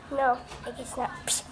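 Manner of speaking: ordinary delivery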